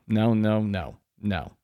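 ordinary talk, nothing marked

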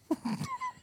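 high squealing laugh; breathless shrill laugh burst; shrieking laugh. Real, not acted. Loud laugh at guess.

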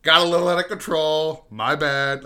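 sheepish apology. Retold or imitated. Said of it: "I was just like".

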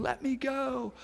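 high, pleading little girl, lightly acted; struggling child. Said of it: "she's struggling and fighting".

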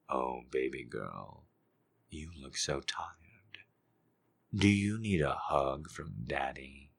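soft, low, slow whispered croon; hushed daddy voice; soft seductive daddy. Acted. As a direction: lean in and croon softly.

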